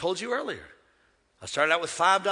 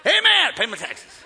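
mock congregation amen; 8 semitones higher, 10 dB louder than usual. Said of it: "slip your hand up and say".

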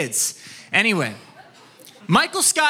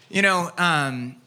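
usual speaking voice

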